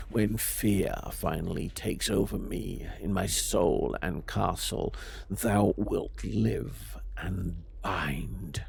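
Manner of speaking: low, measured verse reading; poem reading. Acted. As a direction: read it like an old poem, low and hushed. Reads a verse aloud.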